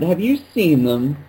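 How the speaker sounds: high-pitched, drawn-out play-acting; mock worried sock owner. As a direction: act out a hammed-up household exchange. Playing out the owners' imagined conversation.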